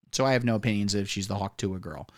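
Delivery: ordinary talk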